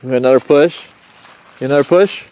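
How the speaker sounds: loud, bright sing-song question. Real, not acted. Pushing baby on swing.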